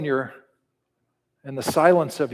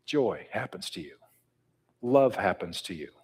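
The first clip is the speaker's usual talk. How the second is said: whispered, dropped low; 6 semitones lower, 7 dB quieter than usual. Acted. Preacher whispering the answer to the congregation. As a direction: breathe it like a secret.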